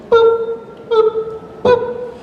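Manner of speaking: three short high boops; high beep countdown. Shown as a demonstration. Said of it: "Ready, Joe?".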